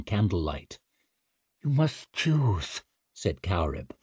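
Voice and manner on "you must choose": slow solemn witch. Acted. Witch urges slowly.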